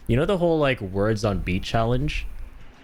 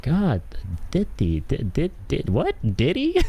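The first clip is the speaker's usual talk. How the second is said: halting syllables leaping to a high question; baffled stammer; 6 semitones higher, slower than usual. Retold or imitated. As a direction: imitate a friend's incredulous double-take. Re-enacts friend's confused stammering.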